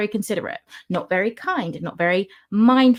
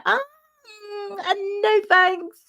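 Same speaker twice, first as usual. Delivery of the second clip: high, drawn-out squeamish no; awkward polite refusal; 13 semitones higher, slower than usual. Retold or imitated. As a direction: refuse awkwardly, pitched right up. Awkwardly refusing an unwanted reading.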